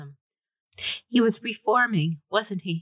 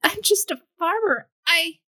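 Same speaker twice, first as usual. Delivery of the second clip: much higher and louder, on the edge of breaking; a frightened farmer pleading he is out of his depth; high, trembling, near-sobbing voice; trembling panic; 12 semitones higher, 4 dB louder than usual. Acted. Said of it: "he trembled".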